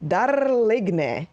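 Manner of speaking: botched mock-French with rolled R; clownishly mangled French accent; attempted French accent. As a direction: overdo a bad French accent, rolling the R. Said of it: "I can't do accents".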